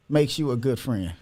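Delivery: normal talking voice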